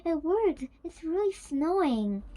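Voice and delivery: loud, high and thrilled; excited squealing exclamation; delighted squeal. A narrator's reading. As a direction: cry out in delighted amazement. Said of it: "squealed with delight".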